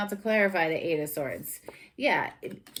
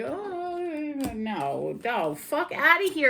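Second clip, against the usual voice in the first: mock dismissal; 7 semitones higher, slower than usual. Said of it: "with this little page like".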